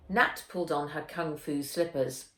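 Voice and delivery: usual speaking voice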